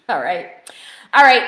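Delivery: ordinary talk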